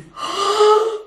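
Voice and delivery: big high theatrical gasp; drawn-out gasp of mock discovery. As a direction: gasp in pretend amazement. Mock amazement at the meaning.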